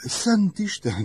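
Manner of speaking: high, loud exclamation of surprise; surprised cry. A narrator's reading. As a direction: gasp it out, caught off guard. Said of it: "kiáltott fel Eve". (meglepett, magas felkiáltás)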